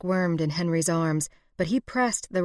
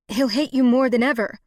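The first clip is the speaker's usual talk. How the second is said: higher, louder defiant outburst; defiant cry; 7 semitones higher, 7 dB louder than usual. Acted. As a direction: fling it at her captor. Hostage defies her captor.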